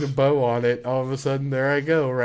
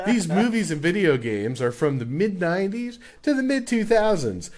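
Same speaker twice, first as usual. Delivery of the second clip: game-show host; 3 semitones higher than usual. Acted. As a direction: punch it out like a hyped show host. Hosts as game-show quizmaster.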